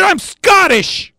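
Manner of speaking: high, slow proclamation; shouted punchline retort. Acted. Preacher revealing himself to the crowd, indignant shout. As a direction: draw it out in a high, indignant yell.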